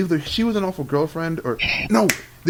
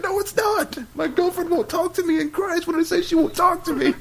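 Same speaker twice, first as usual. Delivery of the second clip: very high, whiny imitation of a distraught friend; mock wailing. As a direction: wail it in a squeaky hammed-up voice. Mimics friend wailing about girlfriend.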